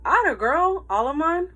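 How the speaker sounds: high, loud proud praise; proud shout. A narrator's reading. Father shouting praise to his daughter, proud. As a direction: cheer her on from behind. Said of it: "my father shouted".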